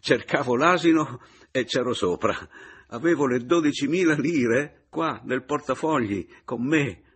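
loud, high, forced cheer; forced joviality. A narrator's reading. Narrator joking about his mistake, forced cheer. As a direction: make light of it through a forced smile. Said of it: "ripresi sforzandomi a sorridere".

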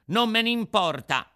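high, loud stubborn retort; defiant puppet child. Acted. Puppet to the warning Fairy, defiant. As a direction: throw it back high and loud.

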